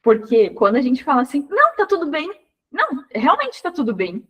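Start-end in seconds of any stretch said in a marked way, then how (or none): 1.5-4.3 sarcastic reassurance, pitched up on não; mock-cheerful denial with an ironic edge. Shown as a demonstration. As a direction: say it's fine while clearly meaning it isn't. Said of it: "Você tá sendo irônica".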